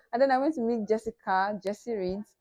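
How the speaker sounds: ordinary talk, nothing marked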